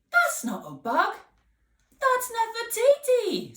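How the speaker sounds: high, loud realisation; child realising the bug is Nefertiti; amazed. Acted. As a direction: correct him in surprise. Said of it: "said Pippa".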